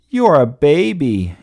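high, loud taunt; yelling. A narrator's reading. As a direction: yell it as a playground taunt. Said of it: "yelled Alfred".

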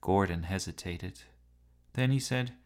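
usual speaking voice

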